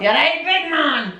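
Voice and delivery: high, drawn-out mock Scottish call; broad Scottish impression. Imitating locals' greeting to a big man, broad Scottish.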